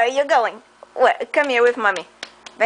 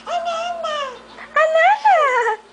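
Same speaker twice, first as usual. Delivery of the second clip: calling out; slower than usual. Real, not acted. Mother calling out.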